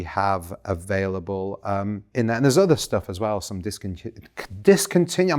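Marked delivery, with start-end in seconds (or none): 4.6-5.4 voice cracking. Real, not acted. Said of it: "my voice is not working".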